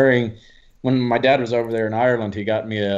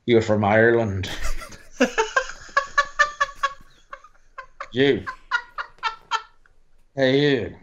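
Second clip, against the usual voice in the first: put-on Irish drunk; slower than usual. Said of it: "He's Irish".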